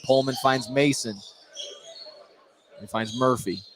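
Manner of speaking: ordinary talk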